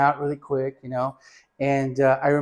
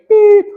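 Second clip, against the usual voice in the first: much higher, louder mock machine beep; high beep imitation; 13 dB louder than usual. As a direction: squeak out a beep like the X-ray machine. Imitating the X-ray machine sound.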